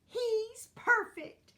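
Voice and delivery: high, slow, loud singsong. A narrator's reading. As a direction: sing it out adoringly. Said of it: "Dot and Zinnia sang".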